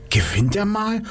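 high, incredulous murmur from the stands; a bystander who can't believe it; astonished crowd voice. Acted. Crowd member doubting Joe can win, high and astonished.

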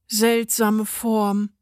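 slow, drawn-out musing; pondering. A narrator's reading. Customer examines a soggy biscuit, slowly pondering. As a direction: puzzle over it slowly. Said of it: "grübelte sie".

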